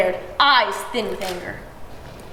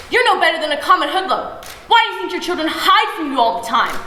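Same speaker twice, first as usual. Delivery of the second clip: angry mother; 6 semitones higher, 4 dB louder than usual. A narrator's reading. Mother berating father.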